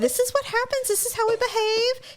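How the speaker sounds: high, exaggerated disapproving sigh; mock dismay. Witnessing a Karen scene, high mock dismay. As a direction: play up your shock at bad manners. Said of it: "I was just like".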